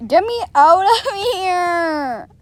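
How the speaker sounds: high, slow wailing cry; loud cry. Real, not acted. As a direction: wail for rescue. Cries out in game panic.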